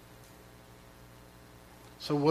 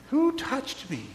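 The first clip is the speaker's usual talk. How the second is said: searching question; 9 semitones higher than usual. Acted. Jesus's question repeated.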